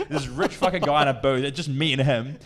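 ordinary talk

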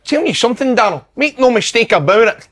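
high, bright commentator patter; a quick pundit McCoist send-up; Ally McCoist impression. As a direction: lift the pitch and gush like the pundit. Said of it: "Does it sound like him?".

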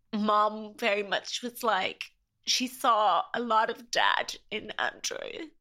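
slow, voice thick with real tears; tearful. Real, not acted. Guest tearing up as she talks of her dad, tearful. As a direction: hold back crying as you talk about dad. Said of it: "That makes me really emotional".

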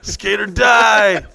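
battle cry. Acted. Joking with friend, drawn-out shouted skater war cry.

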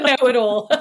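normal talking voice

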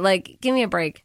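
usual speaking voice

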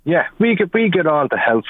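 usual speaking voice

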